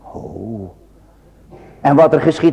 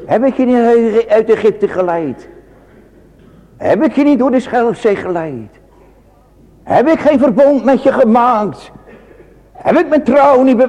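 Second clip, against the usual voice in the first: God's pleading voice; 9 semitones higher than usual. Acted. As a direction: plead as the Lord to his people. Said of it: "De Heere gaat zelf antwoord geven". (hoger en luider smekend, als de Heere)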